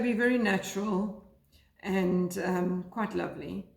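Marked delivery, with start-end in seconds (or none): none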